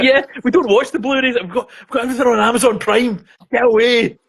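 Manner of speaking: husband waving off his wife, mock annoyed; mock gruff husband. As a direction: brush her off in a put-on voice. Husband voiced dismissing wife.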